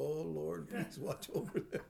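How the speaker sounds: breathless, hushed little prayer. Retold or imitated. Grandmother praying for her granddaughters, hushed and whispery. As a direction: pray it under your breath.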